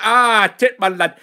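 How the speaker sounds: loud, high burst of caricature Yorkshire; mock Yorkshire accent. Host slipping back into the requested Yorkshire accent, loud parody. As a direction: blurt it out in a hammed-up Yorkshire accent. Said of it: "give us your best Yorkshire accent".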